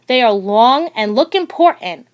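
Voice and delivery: plain reading voice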